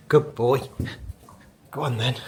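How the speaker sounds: loud, bright praise for the dog; praising. Real, not acted. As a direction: cheer him on.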